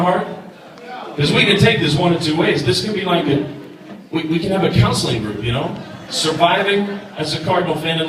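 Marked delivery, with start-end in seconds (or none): none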